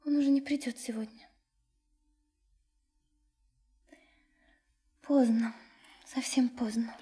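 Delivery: soft, resigned whisper. Acted. Young woman late at night, resigned whisper. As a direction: quietly give up hope.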